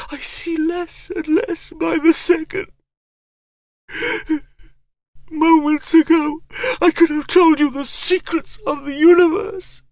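high, strained, rising to a cry; anguished sorcerer. A narrator's reading. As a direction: grieve loudly for lost knowledge.

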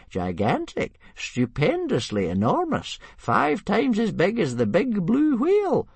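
climbing to high, drawn-out glee; happy interruption. A narrator's reading. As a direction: relish each word, pitch soaring. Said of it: "interrupted Old Wrinkly happily".

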